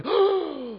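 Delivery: a sudden high gasp of breath; sharp gasp. Shown as a demonstration. Teacher shows the class the gasp, sharp gasp. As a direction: suck the air in sharply. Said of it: "take a sharp intake of breath".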